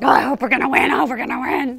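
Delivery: loud, eager athlete's chatter; pumped-up acting of hopeful athletes; excited. Speaker imitates athletes before the game, loud and excited. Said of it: "They're excited".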